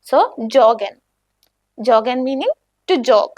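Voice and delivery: normal talking voice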